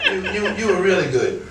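real laughter in the words; laughing. Real, not acted. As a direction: praise through laughter. Still laughing while praising.

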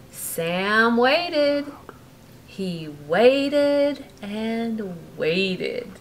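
lively bedtime storybook reading; reading to a child. A narrator's reading. Parent reading a bedtime story to Brooklyn, lively. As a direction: read it like a bedtime story to a little one. Reading picture book aloud.

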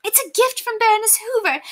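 high put-on boasting voice; boastful lady. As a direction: show off the gift. Boastful lady, first take.

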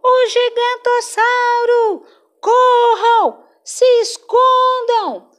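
high, loud panicked cry of warning; shouting alarm. Acted. Cabeçudo shouting warning to friends, high and loud. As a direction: yell to the friends to run and hide. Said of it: "Cabeçudo gritou".

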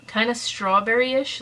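usual speaking voice

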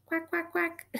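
high quacking duck; duck quacks. Acted. Duck imitation.

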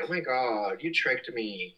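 ordinary talk

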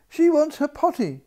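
high, loud alarmed call; maid's loud high alarm; crying out. A narrator's reading. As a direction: shout it up the castle in a panic. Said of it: "cried the maid".

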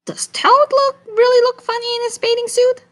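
high, loud, curious little lizards; squeaky lizard voices. Acted. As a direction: squeak it out like tiny nosy lizards. Lizards ask in their voice.

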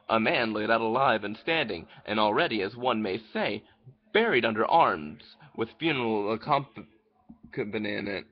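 ordinary talk

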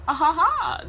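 loud, high giggling "ah ha ha"; giggling laugh. Retold or imitated. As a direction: giggle right in his face. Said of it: "Still laughing".

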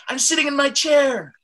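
shouted excitement, very high and loud; excited. Shown as a demonstration. Teacher contrasting two emotions for the class, excited. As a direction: shoot way up in pitch, thrilled. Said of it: "I was excited".

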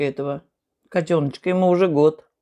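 talking between bites; chewing while speaking; mouth partly full. Real, not acted. Seen 2: Bite taken at -1.0; speaks soon after, fork of rice raised.

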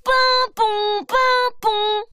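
high, loud siren noise; child imitating a fire siren; siren call. A narrator's reading. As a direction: play fire engine siren. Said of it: "il crie".

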